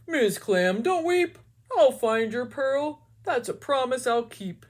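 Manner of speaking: cheerful cartoon fish voice; brave Mister Fish. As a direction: promise it boldly as the little fish.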